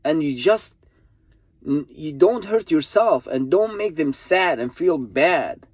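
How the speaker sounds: earnest pleading. Real, not acted. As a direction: plead with them to stay safe, voice climbing.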